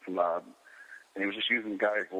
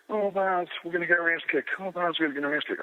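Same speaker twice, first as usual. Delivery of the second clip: crying the blues; 10 semitones higher than usual. Retold or imitated. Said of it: "he's in there crying the blues".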